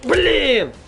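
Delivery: high sharp curse; frustrated exclamation. Real, not acted. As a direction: let the frustration burst out. Frustrated game outburst. (досадный выкрик)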